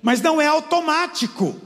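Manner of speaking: preaching at full force. Acted. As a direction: drive the point home to the church. Preaching climax.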